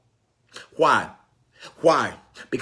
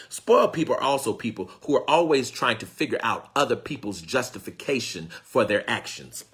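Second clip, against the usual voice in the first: ordinary talk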